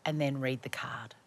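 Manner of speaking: breathy aside whisper to the guest; whispered stage direction, voiceless. Real, not acted. Host whispering the cue to the guest.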